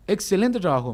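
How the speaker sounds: a hint of a loud president; president's praise. The president praising the young economist, a hint of loud firmness. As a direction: lightly voice the president's firm praise. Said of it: "aparte gritaba".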